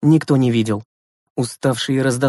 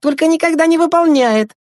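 put-on very high, louder child voice; high-pitched kid chiming in; young boy Andreyka, matter-of-fact; 6 dB louder than usual. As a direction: pipe up like a little brother.

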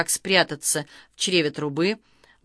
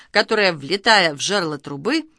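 ordinary delivery

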